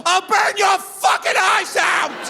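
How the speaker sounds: very high, slow screaming threat; mock-furious mother screaming threat; crazed yell. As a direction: scream it like an unhinged mom. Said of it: "and yells".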